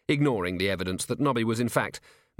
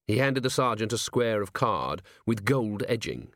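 usual speaking voice

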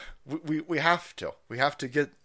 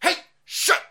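screamed high and loud, hammed-up; a streamer mocked for yelling at his chat, screaming high and loud; mock Tim rant; 11 dB louder, slower than usual. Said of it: "all the clips I saw were of him going".

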